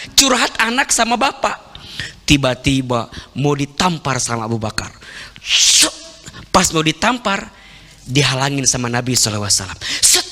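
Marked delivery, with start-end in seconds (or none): 5.4-5.9 a breathy swish of a hand; unvoiced swish; whooshing slap sound. Slap about to land.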